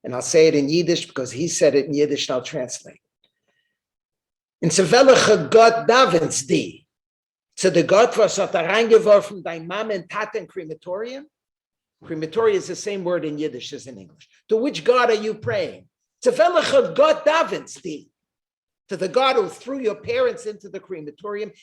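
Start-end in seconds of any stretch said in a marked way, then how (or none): 4.6-11.3 retold scream. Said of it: "screams out at the top of his lungs". 14.5-21.5 retold scream. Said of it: "a guy from the back screams out".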